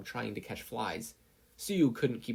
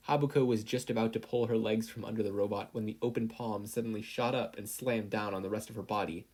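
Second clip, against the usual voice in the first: ordinary delivery, nothing marked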